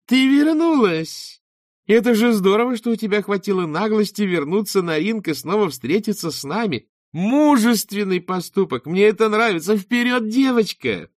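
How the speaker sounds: high, loud mock cheer; catty faked delight; fake enthusiasm. A narrator's reading. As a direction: gush with sugary sarcasm. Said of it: "изобразив удивление".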